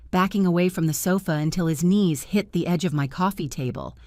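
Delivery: ordinary delivery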